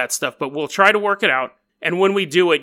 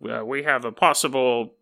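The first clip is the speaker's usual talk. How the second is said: radio dispatcher; slower than usual. Retold or imitated. Nine one one operator calling cops over radio. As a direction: talk like a crackly CB radio call. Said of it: "calls the radio".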